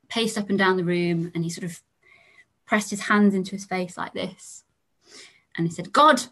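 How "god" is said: sudden loud cry, pitched high; loud cry of God. Retold or imitated. Grieving son cries out to God, sudden and loud. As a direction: son blurts it out in shock. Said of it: "very loudly, which made us all jump".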